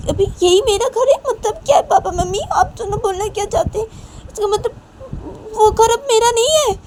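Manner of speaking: very high, loud wail of protest; acted daughter's hurt outburst, high and insistent; indignant outburst. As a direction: push the voice way up, loudly aggrieved. Married daughter protests about her home.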